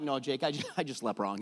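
low and sheepish, half laughing; a hint of a sheepish dad answering his son; low sheepish reply. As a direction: mumble it like a sore, embarrassed dad. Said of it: "I'm like".

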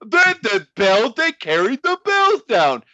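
high, slow strained announcer impression; loud mock play-by-play, drawn out; Jim Ross. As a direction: force out the call as a high-voiced announcer. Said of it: "what are we doing again, Jim".

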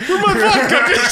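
high squealing laugh-talk. Pretend. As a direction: shriek it while laughing. Mock Russian, high laughing.

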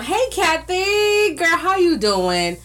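long, high, drawn-out greeting; excited call-out. Real, not acted. Host greets a viewer joining live, excited call-out. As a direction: sing out the hello, stretched slow and high.

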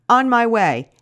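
loud, quick "On my way"; yelling. Retold or imitated. Friend yelling down the phone before hanging up, loud. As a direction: call it out, already moving. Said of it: "she yells".

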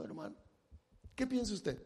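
ordinary delivery

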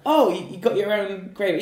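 high, loud, exaggerated surprise; mock surprised waiter. Waiter reacting to customer's homemade gravy, mock surprised. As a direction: play the waiter's fake astonishment. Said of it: "I was like".

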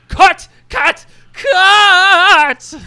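high, loud director's shriek; screaming. Acted. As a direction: shriek it, dragging out the last one. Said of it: "He screams".

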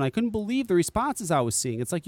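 ordinary talk

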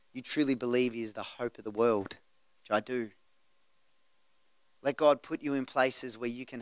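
low, soft closing exhortation; hushed pastoral appeal. Acted. Preacher gently urging the congregation to go where love leads, hushed.

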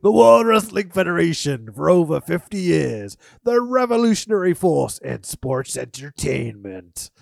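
booming wrestling announcer voice. Shown as a demonstration. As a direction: boom it out like a promo announcer. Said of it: "like, an announcer voice there".

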